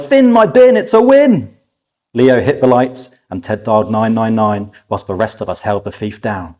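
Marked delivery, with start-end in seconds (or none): 0.0-1.6 high, loud triumphant shout; excited yell. Acted. Zach yelling in triumph as the thief goes down. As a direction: yell it out in victory. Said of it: "Zach yelled".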